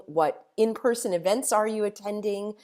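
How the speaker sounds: ordinary talk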